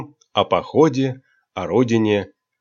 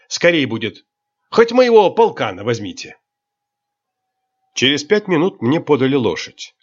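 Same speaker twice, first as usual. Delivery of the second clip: ordinary delivery, nothing marked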